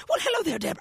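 put-on high, lightly done Irish accent; badly faked Irish lilt; attempted Irish accent. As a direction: greet her in a shaky-attempt Irish accent. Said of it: "I can't do an Irish accent".

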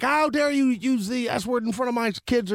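high-pitched offended parent, lightly acted; mock outrage. Parent scolding someone for swearing near kids, mock outrage. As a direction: pretend to be scandalized, pitch lifted high.

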